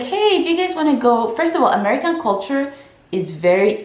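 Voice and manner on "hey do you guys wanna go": high-pitched mock friendly invitation; acted eager invite. Immigrant wanting American friends, high eager invite. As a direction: play the friend-seeker, pitched well up. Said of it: "when you're like".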